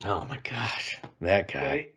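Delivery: hushed, whispered disbelief; exasperated whisper. Real, not acted. Co-host reacting to mention of Lane Kiffin, exasperated whisper. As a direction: whisper it under your breath, appalled. Groaning at a coach's name.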